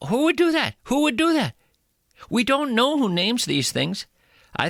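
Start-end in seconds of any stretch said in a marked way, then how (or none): 0.1-0.7 high, squeaky indignant question; high mock outrage. Comic host asking who names creatures, high and indignant. 0.8-1.5 high, squeaky indignant question; high mock outrage. Comic host asking who names creatures, high and indignant.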